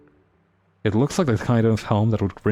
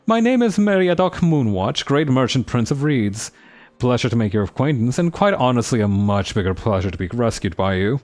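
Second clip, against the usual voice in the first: fancy voice. Acted. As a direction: announce yourself like nobility. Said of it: "a fancy voice".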